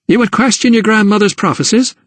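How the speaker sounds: put-on a mother telling off her girl; scolding. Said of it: "she scolded".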